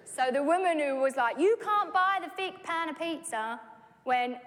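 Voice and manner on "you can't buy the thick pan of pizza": woman's brisk remark. Retold or imitated.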